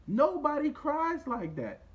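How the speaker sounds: heated slow declaration; heated, loud. Real, not acted. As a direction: lay it down like a verdict. Heated rant about fake crying.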